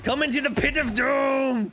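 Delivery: hammed-up bellow to the enemy; shouted taunt. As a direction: yell it to lure him in.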